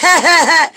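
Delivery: quick high fake giggle; mock giggle. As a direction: toss off a silly churchy giggle. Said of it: "if you don't laugh like".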